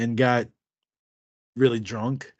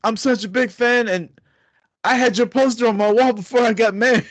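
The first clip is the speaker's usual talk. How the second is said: high, louder gushing; drunk fanboying; 9 semitones higher, 4 dB louder than usual. Retold or imitated. Drunk fan telling Shelton Benjamin about his poster, high giddy gushing. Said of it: "my drunk ass is trying to tell him".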